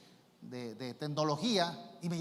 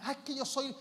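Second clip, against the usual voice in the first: mock jealous wife; 8 semitones higher, 4 dB louder than usual. Wife demanding to be first.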